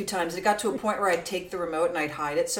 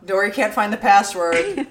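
teasing announcement; 8 dB louder than usual. Retold or imitated. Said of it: "Where's Dory?".

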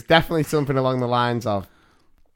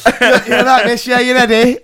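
higher, louder mock dad at parents' evening; 7 semitones higher, 4 dB louder than usual. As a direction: play the dad, loud and eager.

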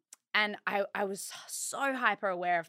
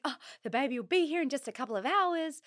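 excited; 7 semitones higher, faster than usual. Retold or imitated. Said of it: "I was like".

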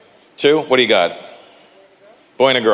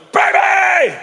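put-on high, loud excited call of "Baby!"; 3 dB louder than usual. Dad bursting into his daughter's room, a loud high excited call. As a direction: burst in calling out, much higher and louder.

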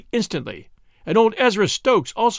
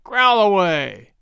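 drawn out and louder, brushing them off; slow dismissive retort; 5 semitones higher, 6 dB louder, slower than usual. A narrator's reading. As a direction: let it roll slowly, unbothered. Cook dismisses the grumblers.

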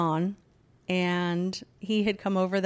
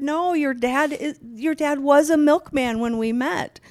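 insistent explaining; 8 semitones higher, 6 dB louder than usual. Retold or imitated. Mother re-enacts pleading with upset son.

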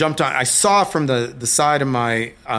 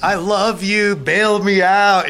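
loud, higher mock plea, drawn out at the end; retold pleading call; 8 semitones higher, 6 dB louder, slower than usual. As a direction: call it out imploringly, as if being dragged away. Calling to wife while arrested.

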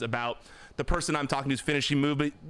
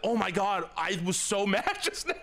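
laughing relief; 5 semitones higher than usual. Retold or imitated. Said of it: "she was like".

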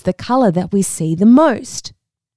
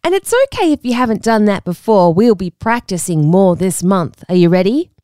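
normal talking voice

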